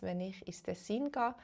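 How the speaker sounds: ordinary talk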